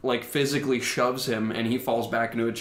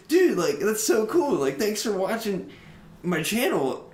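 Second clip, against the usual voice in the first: imitating dude-bro; 9 semitones higher than usual. Imitating the channel guy.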